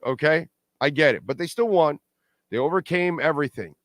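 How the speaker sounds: usual speaking voice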